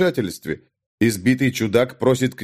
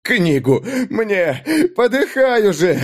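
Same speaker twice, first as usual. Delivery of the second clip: desperate demand; 9 semitones higher, 3 dB louder, slower than usual. A narrator's reading. Desperate youth demanding book.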